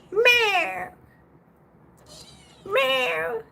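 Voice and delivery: high imitated meow; cat meow. Owner meowing back to the kittens in a high cat voice.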